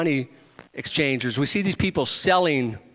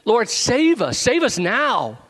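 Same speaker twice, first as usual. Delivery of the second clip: raised, pleading cry to the Lord; louder, higher plea for rescue; crowd shouting; 7 semitones higher, 4 dB louder, slower than usual. Retold or imitated. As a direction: call it out like the crowd begging to be saved. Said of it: "they're sa-, shouting".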